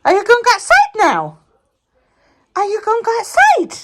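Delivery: very high sing-song questions. Real, not acted. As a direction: coax the dogs outside. Asking dogs.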